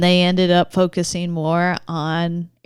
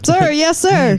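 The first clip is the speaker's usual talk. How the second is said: barked sir yes sir; 9 semitones higher, 4 dB louder than usual. Pretend. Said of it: "I'll be a sergeant".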